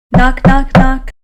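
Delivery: knock, knock, knock, rapped out loud and high. Acted. Narrator sounding out Mouse knocking at Hedgehog's door, loud.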